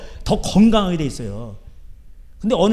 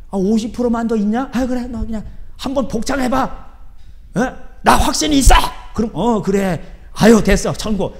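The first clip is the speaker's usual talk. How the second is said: louder, higher hammed-up coaxing; mock lenient judge; 4 semitones higher, 3 dB louder than usual. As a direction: parody a tired Jesus waving people through. Mock weary Jesus at judgment. (지친 예수님을 흉내내며 과장되게)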